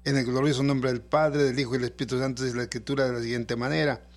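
ordinary delivery

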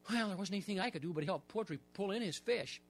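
usual speaking voice

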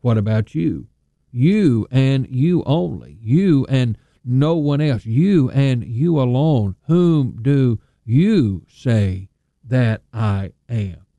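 each you pressed hard, slow and weighty; voicing Jesus, slow and emphatic on you. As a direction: drive the word you home every time. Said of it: "would have sounded much like this".

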